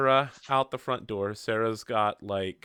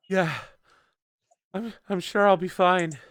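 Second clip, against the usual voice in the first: put-on higher, hesitant voice of a recovering girl; shaken, breathless girl; 8 semitones higher, 4 dB louder than usual. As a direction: play her catching her breath, pitched up. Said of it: "trying to breathe".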